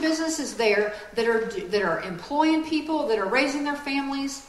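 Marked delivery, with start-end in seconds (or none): none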